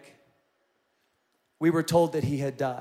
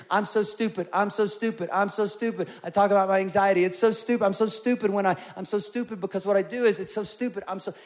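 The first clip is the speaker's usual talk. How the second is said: mimicked self-berating; 4 semitones higher than usual. Counselor mimicking his client's constant self-criticism, mocking repetition. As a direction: imitate someone running himself down on repeat.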